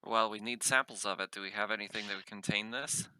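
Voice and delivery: ordinary talk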